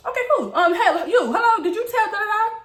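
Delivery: high, insistent questioning; accosting passers-by. Retold or imitated. Teller stops everybody to ask who told, high and insistent.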